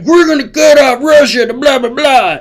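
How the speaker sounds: high, strained mock yelling; Joe Biden. President yelling at everybody from the bed, mock yelling. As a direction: rant in a squawky caricature, dissolving into gibberish. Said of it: "He's yelling at everybody".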